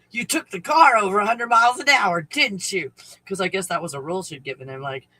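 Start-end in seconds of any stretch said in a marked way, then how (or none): 0.1-2.9 raised, pointed accusation; louder accusing mom. Retold or imitated. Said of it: "Is what she said".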